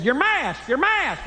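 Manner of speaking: high urgent "Your mask, your mask"; urgent wife. Retold or imitated. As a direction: warn him in a high pitch. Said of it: "And then she said".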